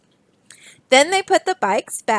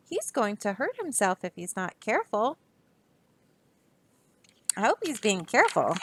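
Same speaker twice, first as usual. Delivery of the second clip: worried inner thought; 9 dB quieter than usual. Acted.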